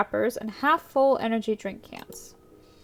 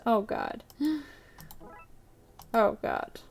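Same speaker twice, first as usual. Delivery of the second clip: whispered dismay; 6 dB quieter, slower than usual. Real, not acted.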